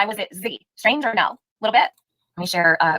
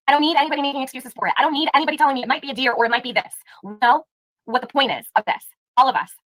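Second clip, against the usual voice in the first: ordinary delivery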